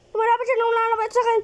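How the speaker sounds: fast high frightened rush; panicked gabbling. Real, not acted. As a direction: babble in terror. Monster closing in.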